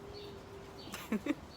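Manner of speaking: a high, soft giggle after the punchline; high breathy laugh. Real, not acted. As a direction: laugh quietly at your own joke. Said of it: "is a joke".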